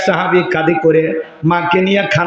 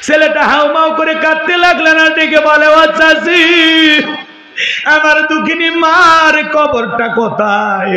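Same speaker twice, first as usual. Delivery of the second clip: wailing sermon; 10 semitones higher, 3 dB louder than usual. Acted. Son wails for dead mother.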